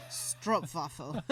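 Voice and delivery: STROOPWAFEL slow and low, "strohp-vah-fol"; Dutch pronunciation. Retold or imitated. Appalled Dutch woman correcting it, slow Dutch-accented stroopwafel. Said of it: "appalled at the pronunciation of".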